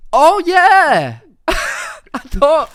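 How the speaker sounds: loud, high delighted cry; thrilled at the idea; excited. Real, not acted. Excited at game choice.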